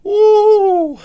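high, loud whoop into laughter; laughing whoop. Real, not acted. Laughing whoop after roar.

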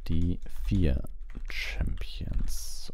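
plain reading voice

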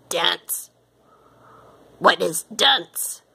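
slow, puzzled alien voice; weird alien voice. A narrator's reading. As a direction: draw it out, baffled creature. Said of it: "asked the space being".

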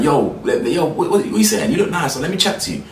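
squeaky parody of a man calling a woman over; mock pushy guy. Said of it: "he's called across the tracks, like".

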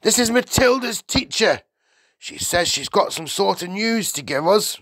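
high, loud husband; put-upon Mr Wormwood. A narrator's reading. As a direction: introduce the visitor loudly and grudgingly.

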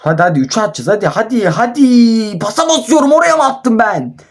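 building to a high, exasperated yell; frustrated yelling. Real, not acted. Gamer urging on then scolding their players, frustrated yelling. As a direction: climb from urging into a high protest.

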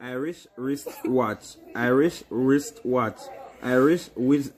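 slow deliberate tongue twister; slow, careful tongue twister; slow careful try. Shown as a demonstration. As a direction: spell it out slowly. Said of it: "Say it fast".

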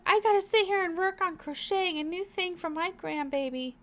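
high, loud grandma chatter; excited grandma. Acted. Grandma off to crochet for her grandbaby, excited. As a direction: pitch it up as a delighted grandma. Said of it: "she's like".

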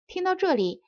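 usual speaking voice

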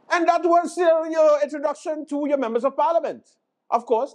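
high, sing-out presenter voice; grand mock presenter flourish; show host wrap-up. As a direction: announce it high and stretched. Host wraps up the sketch.

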